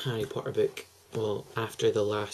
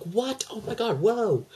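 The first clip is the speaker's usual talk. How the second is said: much higher, stunned gasping exclaim; shocked; 10 semitones higher, slower than usual. Retold or imitated. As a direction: squeal with disbelief at the twist. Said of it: "you're like".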